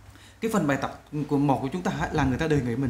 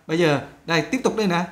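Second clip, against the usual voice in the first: normal talking voice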